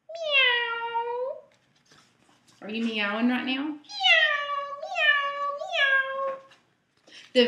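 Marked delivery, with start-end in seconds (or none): 0.1-1.4 high, drawn-out mock meow; cat meow. Dog says meow in story. 3.6-6.3 high little meows; cat meows. Acted. Repeats George's meows.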